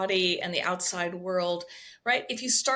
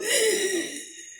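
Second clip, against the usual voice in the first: big forced noisy inhale, imitated; exaggerated deep breath; 9 semitones higher than usual. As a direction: suck in air hard, badly done deep breath. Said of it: "People go".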